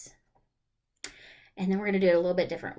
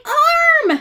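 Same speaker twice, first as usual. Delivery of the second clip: high, loud cry of the rhyme word; shout; 14 dB louder than usual. Acted. Teacher crying out the rhyme word for kids. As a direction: blurt the word out big and high.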